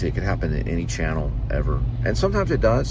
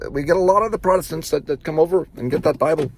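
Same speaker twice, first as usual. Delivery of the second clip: put-on Irish accent; 9 semitones higher than usual. Salesman pitching Bibles to customers, in imitated Irish accent. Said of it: "he would go into an Irish accent".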